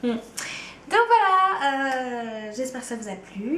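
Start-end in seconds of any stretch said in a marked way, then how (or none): 0.9-1.6 high, louder "Donc voilà" to sign off; bright outro. Real, not acted. She wraps up the video for viewers, bright and upbeat. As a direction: pop up cheerfully to close the video.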